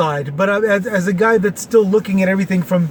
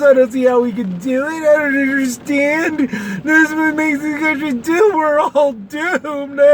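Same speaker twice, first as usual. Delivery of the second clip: higher, slowed whiny voice of a doomsayer; mock doom wail; 7 semitones higher than usual. Parodying people across the aisle, higher mock doom wail. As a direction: wail it like the end of the world, hammed up. Mocking the other side's doom talk.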